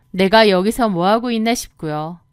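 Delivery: plain reading voice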